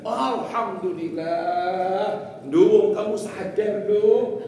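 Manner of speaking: husband's elated shout; relieved outcry. Retold or imitated. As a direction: exclaim slowly and loudly, voice lifting.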